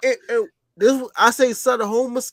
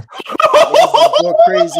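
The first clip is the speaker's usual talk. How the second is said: laughing; 11 dB louder, slower than usual. Real, not acted. Laughing while recalling a drink.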